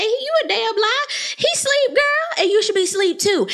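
put-on high-pitched sassy retort, girl; sassy clapback. Host clapping back at Mia, high and sassy. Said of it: "I want to say".

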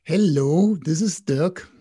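playful put-on California accent; attempted California accent; Californian accent. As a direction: greet them in a mock Californian accent. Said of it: "doing his Californian accent".

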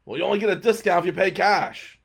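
pushy clerk, voiced in a story; hotel clerk's retort. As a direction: lay down the rule loudly.

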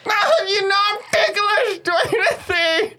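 high laughing cry; being tickled. Acted. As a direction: squeal through the laughter. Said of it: "cackling with laughter".

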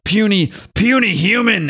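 high, stretched mock-Hulk jeer; scornful monster taunt. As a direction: sneer it like a comic-book brute. Acts Hulk taunting his father.

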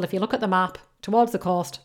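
ordinary talk, nothing marked

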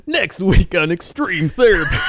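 high, loud mock promo voice; TV promo announcer. Joking promo for a therapy show, loud announcer hype. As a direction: hype it like a next-episode teaser.

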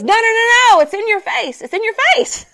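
insist, wagging a finger; insistent friend. Retold or imitated. Homeless friend insists the weight shows in her face, high insistent voice. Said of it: "He goes".

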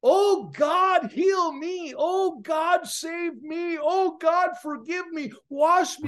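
desperate high, loud plea to God; anguished shouted prayer, retold in full force; crying out. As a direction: cry it out from the heart, pitch soaring. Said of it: "as I began to cry out".

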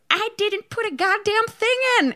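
high panicked realization. Retold or imitated. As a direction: suddenly realize the mistake in panic. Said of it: "I'll realize I'm like".

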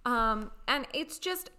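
usual speaking voice

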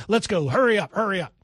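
mild mock impatience; impatient hurry. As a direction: rush the reporter along, half-acted. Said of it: "He's like".

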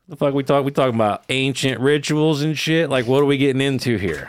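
mock alarm at ancient rituals; incredulous inner voice. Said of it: "I'm thinking".

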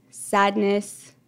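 ordinary delivery, nothing marked

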